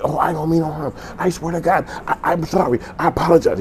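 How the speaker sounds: squealed, high-pitched mock pleading; mock frantic apology. Caught man apologizing to the speaker, mock panicked squeal. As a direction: plead loudly in a put-on high voice.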